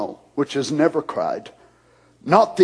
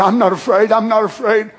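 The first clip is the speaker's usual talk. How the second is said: mock brave claim; 6 semitones higher, 4 dB louder than usual. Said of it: "And to say".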